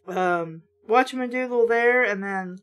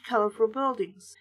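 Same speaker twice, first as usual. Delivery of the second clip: plain reading voice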